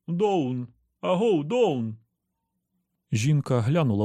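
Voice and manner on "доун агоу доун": higher-pitched call, without real volume. A narrator's reading. Bun hails Dawn below through cupped hands, restrained call. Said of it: "склав долоні рупором і голосно вигукнув".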